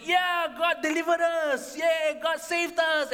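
high, drawn-out jubilant shout; happy cheering. Acted. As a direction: whoop slowly like a rescued crowd. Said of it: "And they were happy".

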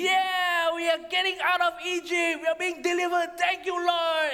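high, jubilant cheering; happy. Acted. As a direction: shout for joy like a freed people.

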